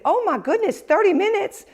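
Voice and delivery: high, loud shocked exclamation; surprised. Retold or imitated. Rep hearing about the caller's long wait, shocked. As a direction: gasp it out in disbelief.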